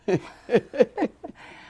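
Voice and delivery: high chuckle at own money loss; laugh. Real, not acted. Rueful at losing money.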